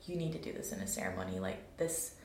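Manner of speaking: very low, fast, commanding; deep inner voice. Retold or imitated. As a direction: drop way down like a voice within. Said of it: "that was my download from that time was".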